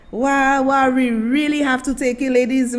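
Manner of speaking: normal talking voice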